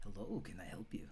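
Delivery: soft, breathy whisper of a shy florist; hushed stall keeper greeting; hushed shopkeeper. Acted. NPC Erland voiced by GM.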